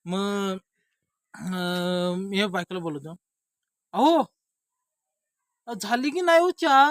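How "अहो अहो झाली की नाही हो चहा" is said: high, loud call to another room. Acted. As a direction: shout to the wife for tea. Calls to wife for tea. (मोठ्याने हाक मारून विचारणे)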